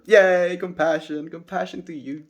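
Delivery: loud high happy yay; excited cheer. Real, not acted. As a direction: burst out cheering. Cheering a chat message.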